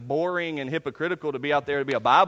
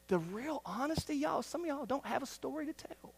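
soft honest aside; 8 semitones higher, 10 dB quieter than usual. Real, not acted. Confessional aside to congregation.

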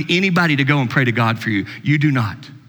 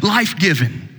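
ordinary delivery

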